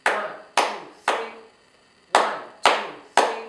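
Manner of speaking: ONE, TWO, THREE as separate even beats; three-beat count. Shown as a demonstration. Teacher demonstrating three syllables, clapped count of ONE, TWO, THREE. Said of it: "do ambulance with clapping".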